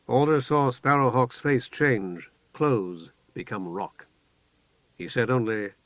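plain reading voice

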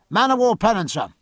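high, carrying call from aloft; lookout's hail. A narrator's reading. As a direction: sing out the sighting. Lookout calls down further sightings.

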